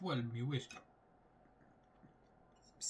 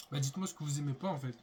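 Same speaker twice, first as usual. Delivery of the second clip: ordinary talk, nothing marked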